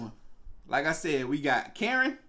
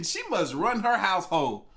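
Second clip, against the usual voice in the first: ordinary talk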